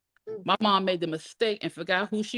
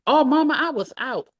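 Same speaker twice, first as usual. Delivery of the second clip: put-on higher, louder teenage protest; pleading daughter; 8 semitones higher, 5 dB louder than usual. A narrator's reading. Daughter dodging her mother's questioning, pleading. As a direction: whine an excuse up high to Mama.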